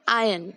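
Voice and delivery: आयन said "आयन" with no r; teacher's model: "आयन" without an r sound; correct pronunciation. Shown as a demonstration. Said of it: "इस word को हम बोलते हैं". (सही उच्चारण: iron को "आयन" बोलें, R साइलेंट)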